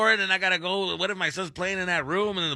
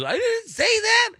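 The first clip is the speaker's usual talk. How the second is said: much higher, slow, loud wailing denial; imitating a crying kid; 5 dB louder, slower than usual. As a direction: whine it like a kid about to burst into tears. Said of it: "He starts crying".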